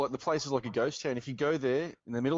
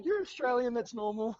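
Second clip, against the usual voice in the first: imitating the shopkeeper; 11 semitones higher than usual. Mimicking shop guy's amused reply.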